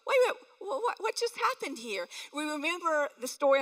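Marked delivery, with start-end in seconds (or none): 0.1-2.1 high, sudden startled voice; startled double take. Retold or imitated. Preacher voicing a churchgoer stunned by a sign, startled. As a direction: act out being caught off guard, pitch jumping up.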